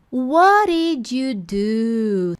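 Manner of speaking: slow, loud model question; slow demo. Shown as a demonstration. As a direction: model "What did you do?" slowly and clearly. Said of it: "slow pronunciation demo". (modelo lento e claro da pergunta)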